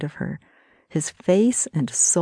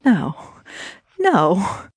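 higher, pained little no; distressed refusal; 3 semitones higher than usual. Acted. Brittany refuses, shaking her head.

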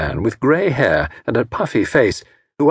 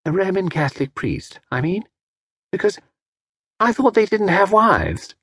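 put-on naive young actress; 9 semitones higher than usual. A narrator's reading. Actress asking Tommy if he is a priest, innocent. Gilda's voice continues.

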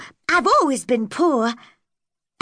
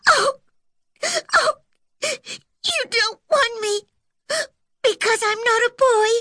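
sobbing; 8 semitones higher than usual. A narrator's reading. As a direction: break down crying on each word. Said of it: "she sobbed".

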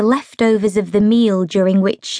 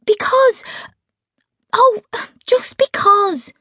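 much higher, slow, lost for words; flustered Meg; 13 semitones higher, slower than usual. A narrator's reading. Meg stuck for an answer in the argument, flustered. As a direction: Meg fumbling for any reason at all.

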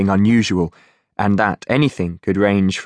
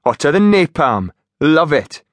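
higher relishing Geordie; Geordie accent; 6 semitones higher, 3 dB louder than usual. Acted. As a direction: savour the heat, Geordie. Finch relishing.

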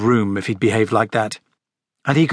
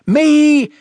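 high, loud cry; eager chorus; 6 dB louder than usual. Acted. Go-Go's answering Harry's invitation, excited high shout. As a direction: shout it like an eager gang of children. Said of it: "chorused the Go-Go's".

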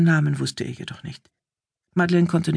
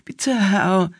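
higher-pitched beseeching servant; pleading; 5 semitones higher than usual. A narrator's reading. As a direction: beg your master anxiously. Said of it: "flehte der Bursch". (flehend, mit höherer Stimme)

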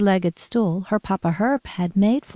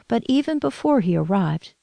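plain reading voice

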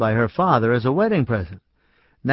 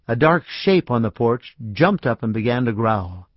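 usual speaking voice

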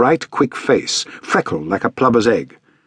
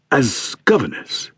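slow, put-on genial gentleman; lightly voiced stout man; 3 semitones higher, slower than usual. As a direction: ask it like a kindly fat man. Stout man questions governess.

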